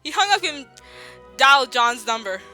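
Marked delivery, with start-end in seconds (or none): none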